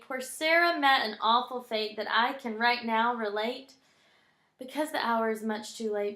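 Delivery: lively story reading settling toward the ending; winding-down poem reading. A narrator's reading. Reading the poem's ending to Brooks, settling down. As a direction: tell the sad fate with a playful lilt.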